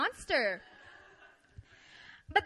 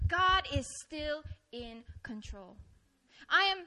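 ordinary talk, nothing marked

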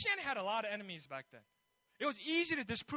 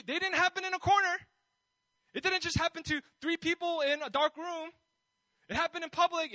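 higher, louder bold proclamation; bold Paul; 5 semitones higher, 5 dB louder than usual. Retold or imitated. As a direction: preach it straight at the king with full confidence. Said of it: "Can you hear the boldness".

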